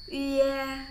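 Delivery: high, loud "Ie" starting on rounded ü; long "Ie" said as ü gliding to eh; as if about to retch. Shown as a demonstration. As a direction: pout into "Ie" like a held-back retch, üe. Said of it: "kayak agak mau muntah".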